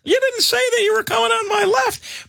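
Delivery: high-pitched indignant holler; retold heckling yell. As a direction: call out after the rider, voice way up. Retold heckling of passing e-biker.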